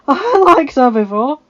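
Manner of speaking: high, loud figure voice; amused companion. A narrator's reading. Companion approving Arya's comeback, amused. Amused former nobleman speaks.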